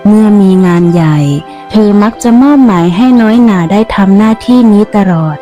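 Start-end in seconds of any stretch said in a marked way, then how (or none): none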